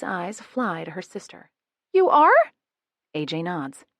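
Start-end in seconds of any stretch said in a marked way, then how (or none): 1.9-2.5 high, loud startled question; shocked disbelief; surprised. A narrator's reading. Learning sister is pregnant.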